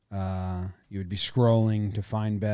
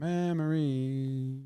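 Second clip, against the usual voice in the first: slow drawn-out echo; 4 dB quieter, much slower than usual. Acted.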